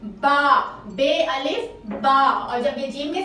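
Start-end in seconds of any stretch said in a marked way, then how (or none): 0.0-0.6 बा called out clearly; loud baa. Shown as a demonstration. Teacher answering her own question, loud baa. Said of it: "इसका क्या sound हो जाएगा". 0.9-2.2 बे अलिफ बा spelled out slowly; slow syllable blending. Shown as a demonstration. Teacher spelling slowly for the class, slow blend. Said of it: "इसका क्या sound हो जाएगा".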